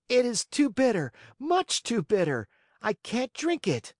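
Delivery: put-on whiny child. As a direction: whine like a spoiled boy refusing medicine. Spoiled puppet refusing medicine.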